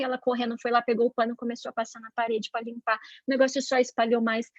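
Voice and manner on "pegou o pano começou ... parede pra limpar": ordinary delivery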